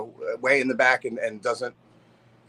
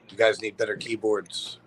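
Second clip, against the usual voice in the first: low, slow, solemn delivery; imitating a soft-spoken, solemn musician; 4 semitones lower, 4 dB quieter than usual. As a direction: say it gravely and unhurriedly, pitched down. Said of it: "with that solemn voice".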